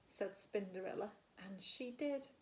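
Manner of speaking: plain reading voice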